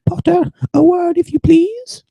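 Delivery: high, loud, strict teacher voice; a stern old witch calling over the noise; McGonagall voice. Acted.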